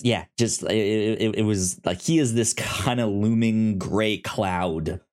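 normal talking voice

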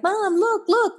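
put-on high-pitched kid begging for attention; eager child. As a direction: call to mom like a small child. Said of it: "You might have been a child".

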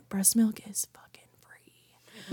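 slow, soft, voiceless confiding; hushed emphatic aside; whispered aside. Real, not acted. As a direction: whisper it like a secret. Aside about cost.

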